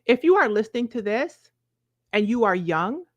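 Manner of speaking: ordinary delivery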